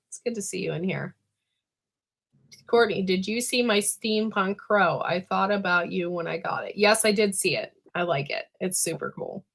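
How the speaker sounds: usual speaking voice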